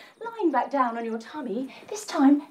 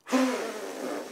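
surprised gasp; 4 semitones lower, 6 dB quieter than usual. Acted. Child survives a blow.

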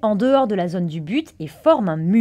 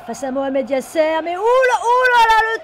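frantic sports commentary; 10 semitones higher than usual. Acted. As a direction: call the tackle in a frenzy. Live commentary of a foul.

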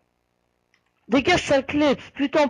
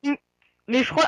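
ordinary talk

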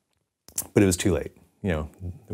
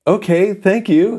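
high, slow, louder retold reply; stunned thanks; 12 semitones higher, 6 dB louder, slower than usual. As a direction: draw it out in disbelief. Stunned acceptance of lucky offer.

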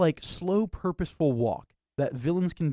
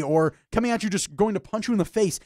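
ordinary talk

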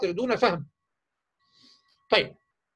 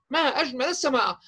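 model sentence for pupils to copy; 4 semitones higher than usual. Shown as a demonstration. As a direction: say it like a model for a child to imitate. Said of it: "يحفظها منك".

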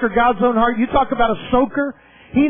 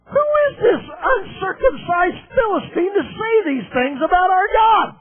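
shouted, slowing on "things about our God"; outraged young shepherd crying out; indignant outcry; 7 semitones higher than usual. Retold or imitated. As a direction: bristle at the insult to God. David's indignant outburst, shouted.

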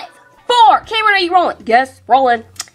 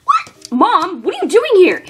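ordinary delivery

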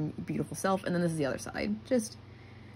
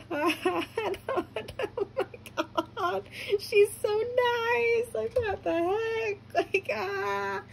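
very high, slow overjoyed wail; happy-tears wail; 13 semitones higher, slower than usual. Retold or imitated. Fan reacting to meeting kind actress, blissful crying wail. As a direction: whine it out, drawn out and overcome. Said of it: "like, all happy tears".

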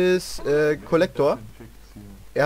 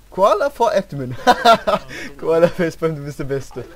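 laughing; 7 dB louder than usual. Real, not acted. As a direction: cheer him on through laughter. Laughing praise of friend.

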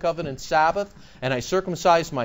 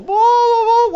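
high, loud mock-crying blah blah; mocking wail, much higher and louder; 7 dB louder, slower than usual. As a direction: ham up a sobbing mourner at the wall. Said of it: "doing your crying thing".

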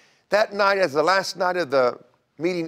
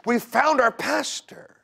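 higher, bursting with good news; excited news; 9 semitones higher than usual. Retold or imitated. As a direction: announce it like a gift, slowed at the end. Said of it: "extra perky and excited".

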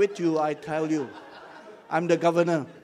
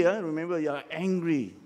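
usual speaking voice

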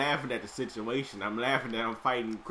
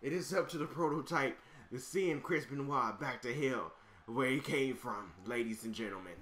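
hype announcer; 2 semitones higher, 4 dB quieter than usual. Acted. Announcing the opponent's return from hell, booming and dramatic. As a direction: sell the villain's arrival to the crowd.

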